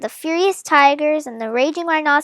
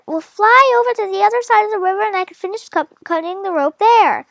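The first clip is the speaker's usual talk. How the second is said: lightly voiced higher father; a hint of a determined father; mildly raised plan-making voice; father speaking; 6 semitones higher, faster than usual. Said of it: "said my father".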